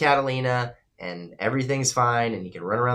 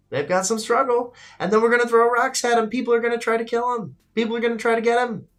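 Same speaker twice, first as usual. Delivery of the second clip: climbing higher and faster into a frantic pitch; building mock panic; 11 semitones higher, 3 dB louder than usual. Shown as a demonstration. Streamer predicts the game's plot to viewers, increasingly frantic. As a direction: wind it up, each trouble higher than the last.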